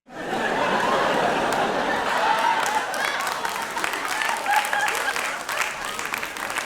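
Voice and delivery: high, airy burst of laughter; loud laughter. Real, not acted. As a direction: crack up hard. Laughing at own joke.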